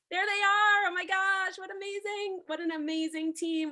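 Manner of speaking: high, loud hype host; excited welcome. Real, not acted. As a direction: hype up the team. Host welcomes team on stage.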